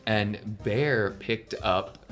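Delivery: ordinary delivery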